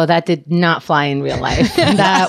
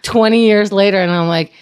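ordinary talk, nothing marked

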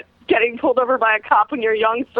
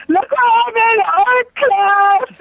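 high slow mock-dopey voice; 9 semitones higher, 4 dB louder, much slower than usual. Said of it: "you're like".

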